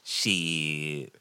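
lightly voiced low sorry pimp; a hint of a sorry pimp. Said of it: "the pimp voice is hilarious".

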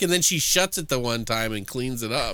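ordinary talk

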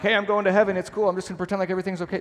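high, breezy, brushing it off; mock carefree denial. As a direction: toss it off lightly like nothing's wrong. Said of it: "It's not to be stuffed down, like".

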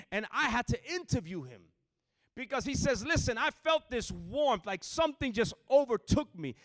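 loud, high preacher's cry; full-force preaching. Acted. Preacher shouting his testimony to the church. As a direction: preach it to the back row.

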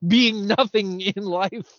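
high, slow, amused proclamation; drawn-out comic boast; mock-triumphant. Real, not acted. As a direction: declare it like a proud achievement. Mock boast about being nobodies.

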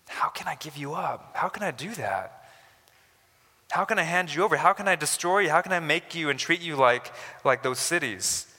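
God's anguished plea swelling; building plea. Acted. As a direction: begin hushed, then cry out each question. God voiced, building plea.

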